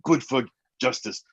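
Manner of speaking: hammy monster catchphrases with gobbling nums; Cookie Monster. Acted. Podcast guest signing off in character, playful monster impression. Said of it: "This is the Cookie Monster".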